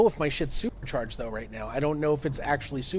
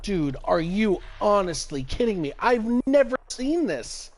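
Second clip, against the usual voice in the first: high, louder, stunned wonder; amazed; 6 semitones higher, 3 dB louder than usual. Real, not acted. Streamer gaping at the strange system, amazed. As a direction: marvel out loud.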